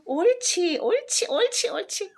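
high, loud cheering praise; excited coaxing. Real, not acted. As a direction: cheer the pet on eagerly. Coaxing the cat to roll.

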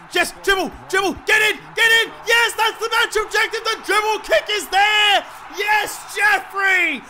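high, loud excited shouting; real gamer triumph; ecstatic celebration. Real, not acted. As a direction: cheer the ball in, then erupt as it scores. Dribble goal completes objective.

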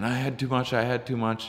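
high-pitched looping panic, mimicked; imitated freak-out. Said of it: "still just over and over again".

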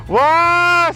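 high, loud amazed wow; excited wow. Real, not acted. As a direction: gasp out a thrilled wow. Sunrise appears.

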